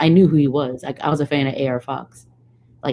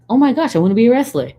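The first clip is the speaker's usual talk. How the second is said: excited; 8 semitones higher, 7 dB louder, faster than usual. Retold or imitated. Eureka moment, quoting her younger self.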